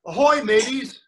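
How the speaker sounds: loud, bright pirate call; pirate greeting. Shown as a demonstration. Teacher leading kids in a pirate hello. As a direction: call it out to the crew like a captain. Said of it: "So let's practice".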